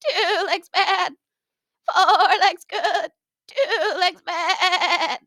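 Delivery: high, slow sheep bleat stretching every word. Acted. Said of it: "they would start bleating".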